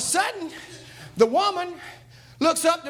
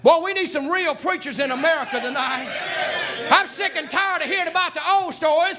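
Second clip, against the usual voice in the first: preaching at full force; 4 semitones higher than usual. Acted. Preacher rousing the church about real preachers, full-force preaching. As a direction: thunder it out to the church like a revival preacher.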